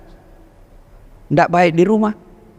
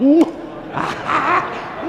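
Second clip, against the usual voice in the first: high, breathy laugh at his own joke; 8 semitones higher, 3 dB quieter than usual. Real, not acted. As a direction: let out a bright laugh.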